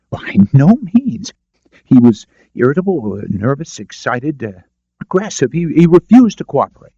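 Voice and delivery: high, stammering, rattled; flustered, pushing back nervously; agitated defensive doctor. A narrator's reading. Cowed doctor defends himself to the lawyer, flustered and stammering.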